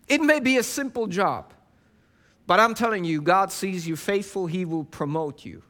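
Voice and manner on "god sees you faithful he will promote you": normal talking voice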